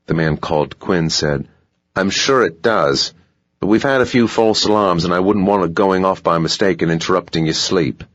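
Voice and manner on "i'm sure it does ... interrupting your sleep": put-on polite hotel clerk in a light South African accent, a bit higher; smooth, dutiful South African manager at the door; a hint of South African, courteous and pitched up. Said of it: "South African accent".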